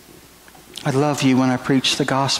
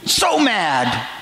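put-on light higher voice, retelling the angry young man; young man voicing his grudge; 6 semitones higher than usual. Preacher retells the young man confessing his anger, lightly voiced. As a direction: let the young man's old grudge show, lightly. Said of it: "He said".

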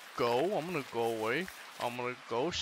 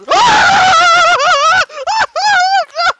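piercing high shriek, far louder than his talk; startled scream; 18 dB louder than usual. Real, not acted. Gamer shrieking at a sudden jump scare. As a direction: let out a real scream of fright.

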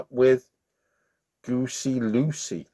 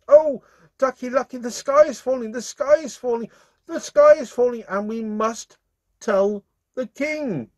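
much higher, alarmed chicken pleading; frantic little chicken; 13 semitones higher, 4 dB louder than usual. Acted. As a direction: warn the duck in a panic. Chicken voice held.

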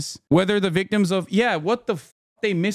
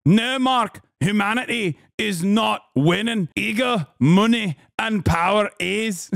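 Scottish accent impression; 3 semitones higher than usual. Mocking Eve Fartlow scolding Mark Ruffalo, Scottish accent. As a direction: lecture Mark in her accent. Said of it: "that's what she sounds like".

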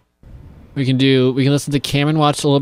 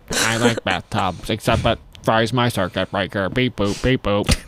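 slow, lowered mock robot; 3 semitones lower than usual. Host playing Robot [name] on bathtubs, mock robot voice. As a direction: talk like a toy robot, measured monotone. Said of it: "what's your robot voice?".

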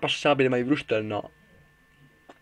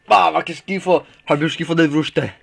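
disgusted shout; 4 semitones higher, 8 dB louder than usual. Real, not acted. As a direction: yell out how gross it tastes. Tasting disgusting lemon candy.

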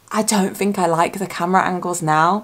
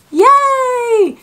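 high, loud, drawn-out squeal of joy; real delighted whoop; excited cheer; 7 dB louder than usual. Real, not acted. Cheering spotting Eldon.

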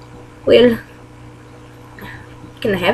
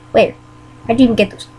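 usual speaking voice

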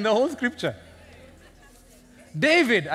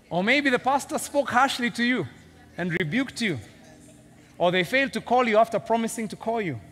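ordinary talk, nothing marked

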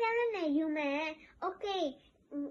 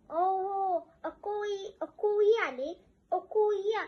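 louder, higher call to big brother; 4 semitones higher, 5 dB louder than usual. Real, not acted. Seller on live calls out to big brother watching, loud. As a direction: raise your voice to catch his attention.

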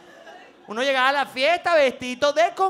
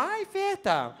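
put-on kid's delight; 4 semitones higher, 6 dB quieter, much slower than usual. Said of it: "Y uno".

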